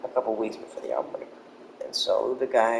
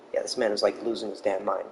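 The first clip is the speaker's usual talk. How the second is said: usual speaking voice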